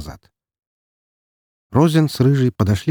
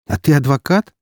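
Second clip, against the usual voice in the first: mocking question, pitched up; teasing jab; 7 semitones higher than usual. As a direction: needle her playfully. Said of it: "не удержался я от подколки".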